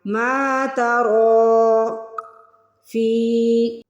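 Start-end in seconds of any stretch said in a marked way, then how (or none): 0.0-1.9 ترى with a heavy ra drawn out, very slow; drawn-out Quranic recitation; slow tajweed recitation. Shown as a demonstration. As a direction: chant ما ترى slowly with a full heavy ra. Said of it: "را کو کھینچ کے بھی ادا کرنا ہے".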